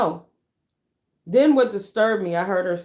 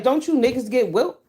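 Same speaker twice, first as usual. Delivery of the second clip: 1 incredulous retort; 6 semitones higher, 4 dB louder than usual. Retold or imitated. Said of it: "I was like".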